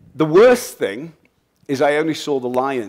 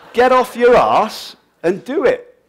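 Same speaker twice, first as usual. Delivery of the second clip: loud, high, drawn-out order; scolding shout; 11 semitones higher, 10 dB louder, slower than usual. Acted. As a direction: holler it slowly at a lazy kid. Acted scolding of lazy kids.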